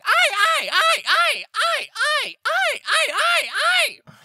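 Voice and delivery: very high, loud bird shrieks; squawking birds. Acted. GM voicing birds squawking down at the player. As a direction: shriek like little monsters. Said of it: "just start squawking".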